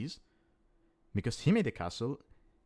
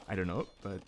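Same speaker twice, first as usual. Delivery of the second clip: ordinary talk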